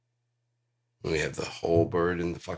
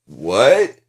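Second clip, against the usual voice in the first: loud stage what; 5 semitones higher, 17 dB louder than usual. Retold or imitated. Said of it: "On stage".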